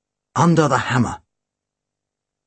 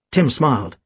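usual speaking voice

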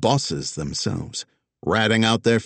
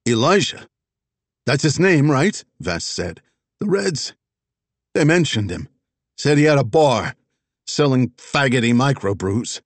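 eager, raised voice; 6 semitones higher, 4 dB louder than usual. Acted. Vess eagerly offers information.

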